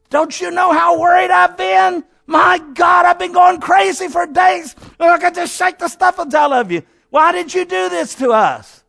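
high, loud, frantic scolding yell; frantic parent yelling. Retold or imitated. A frantic parent yelling at the son who went missing, shouting. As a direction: let the panic burst out at the kid.

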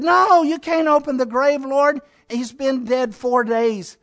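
high-pitched retold objection; alarmed protest. Martha objecting to opening the grave, protesting.

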